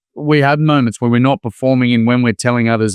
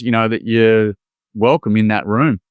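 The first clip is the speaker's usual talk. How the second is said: usual speaking voice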